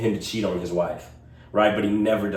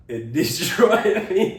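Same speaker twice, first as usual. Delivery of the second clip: laughing through it, higher and slow; 9 semitones higher, 3 dB louder, slower than usual. Real, not acted. Telling a friend the ending killed him, laughing. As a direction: crack up mid-phrase.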